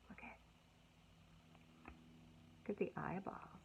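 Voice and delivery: soft whisper; hushed pointing-out. Acted. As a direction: lean in and whisper to the kids. Hushed aside to children.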